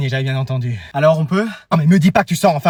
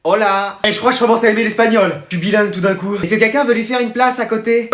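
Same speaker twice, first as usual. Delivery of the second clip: ordinary delivery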